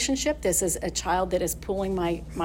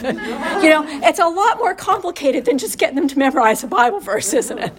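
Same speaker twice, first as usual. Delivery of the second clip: words bubbling with real laughter, a bit louder; laughing aside; 6 semitones higher, 5 dB louder than usual. Real, not acted. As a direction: laugh it out at the absurdity of it. Mother of three sons laughing.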